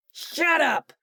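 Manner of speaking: very high, loud scream. A narrator's reading. Hugh screaming at Corbin before firing, loud scream. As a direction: scream him down. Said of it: "Hugh screamed".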